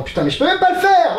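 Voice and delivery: high-pitched exasperated cry; exasperated outburst. Real, not acted. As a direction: whine it high, at your wit's end. Exasperated that the trick fails.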